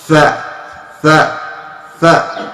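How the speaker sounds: "Tha" with tongue out, air flowing; Arabic tha sound. Shown as a demonstration. Teacher drilling the letter tha for students. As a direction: repeat "tha" letting the air go. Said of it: "Now I have allowed the air to go out".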